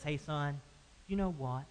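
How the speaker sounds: soft, tender father; a father's quiet loving reply; gracious. Retold or imitated. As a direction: reassure the son gently. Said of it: "It's a very gracious response".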